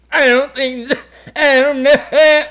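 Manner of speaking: high, loud freaked-out stoner; mock stoned freak-out; panicked stoner. Acts out a panicked stoned smoker.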